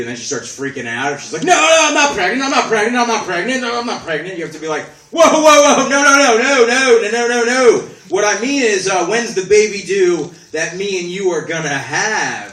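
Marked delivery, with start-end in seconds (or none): 1.4-4.4 high, loud panicked denials; freaking-out woman. Retold or imitated. Woman told about the baby, denying it in a high panic. 5.1-12.5 high, loud rush of no's; frantic backpedal. Retold or imitated. Man to the woman he offended, backpedalling frantically high and loud.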